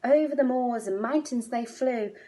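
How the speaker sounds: ordinary delivery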